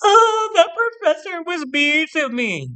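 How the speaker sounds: high, whiny mock crying voice; mock crying girl. Student fake-crying to frame the professor, mock whiny. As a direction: whine it like a fake-hurt student. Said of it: "she crying".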